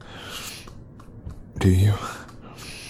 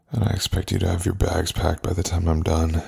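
cold dismissal; 4 dB louder than usual. Acted. Coldly throwing partner out.

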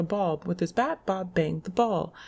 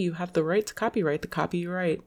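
ordinary talk, nothing marked

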